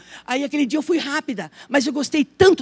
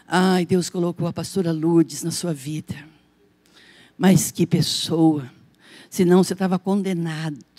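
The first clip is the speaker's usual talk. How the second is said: mimicking a church brother; 7 semitones lower than usual. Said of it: "Teve um irmão que falou assim".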